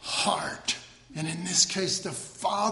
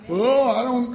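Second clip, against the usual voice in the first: louder, higher mock protest; mock reluctance; 4 semitones higher, 7 dB louder than usual. Preacher mimics believers balking at coming boldly, mock reluctance. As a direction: balk in an exaggerated voice.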